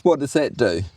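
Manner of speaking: usual speaking voice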